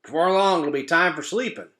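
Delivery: high, loud croaky frog voice; croaking frog. Acted. Frog croaks to another frog, high and loud. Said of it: "croaked one frog".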